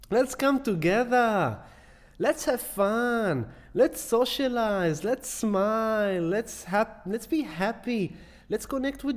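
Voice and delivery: uplifting call. Real, not acted. Host urging viewers to connect, slow and uplifted. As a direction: lift them up, each phrase stretched out.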